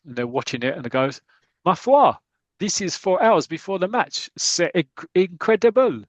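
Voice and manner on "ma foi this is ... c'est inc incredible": put-on higher, louder French-accented exclamation; mock French policeman in amazement; French gendarme. French gendarmes watch fans arrive.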